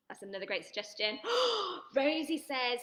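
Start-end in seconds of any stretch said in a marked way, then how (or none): 1.2-1.9 a high, sharp pretend gasp; dramatic mock gasp. Teacher gasping for the class at a scary idea, mock shock.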